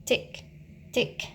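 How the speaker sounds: ordinary delivery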